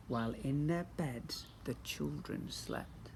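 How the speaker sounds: slow whispered line; slow soft whisper. A narrator's reading. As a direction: hush so you won't wake them. Sleeping children.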